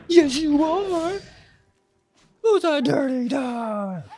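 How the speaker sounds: put-on high, loud, slow baby talk to a dog; high doggy baby talk; pet-talk voice. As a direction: coo at the dog in a squeaky, drawn-out voice.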